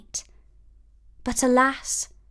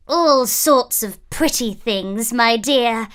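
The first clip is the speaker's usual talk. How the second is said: put-on louder, sly old crone; old peddler woman; 4 dB louder than usual. A narrator's reading. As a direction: coax the girl with sweetness. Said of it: "said the old woman".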